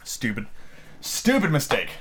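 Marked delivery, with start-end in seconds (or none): none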